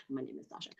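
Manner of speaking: very soft, low repeat of the name; soft murmured self-test; almost inaudible murmur. Shown as a demonstration. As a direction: say it under your breath, barely voiced.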